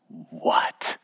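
high, breathy what; wondering child. Retold or imitated. Son asking what happens next, breathy wonder. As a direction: imitate a small boy's hushed curiosity. Father voices son's eager question.

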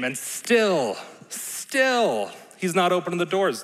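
second still shot up high in mock disbelief; building emphasis. Shown as a demonstration. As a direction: stretch the wait, pitch climbing on still. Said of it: "with a little bit more of that emphasis".

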